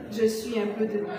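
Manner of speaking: low, soft theatrical aside; understated, softly delivered punchline; hushed conclusion. Acted. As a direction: drop to a quiet, low declaimed close. Hushed close of a list.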